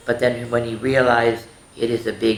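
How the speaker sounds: ordinary delivery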